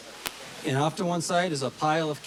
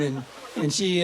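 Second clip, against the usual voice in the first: ordinary talk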